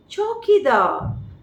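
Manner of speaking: put-on calling out chowkidar in an Englishman's accent, drawn out; English accent. A narrator's reading. The Englishman calls his watchman in an English accent. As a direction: call the watchman like an Englishman would. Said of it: "spoken with the unmistakable accent of an Englishman".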